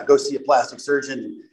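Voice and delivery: ordinary talk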